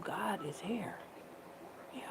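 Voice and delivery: hushed, breathy confiding tone, voice barely there; whisper. Real, not acted. Preaching to viewers, whispering God is here. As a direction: lean in and let it out as a secret.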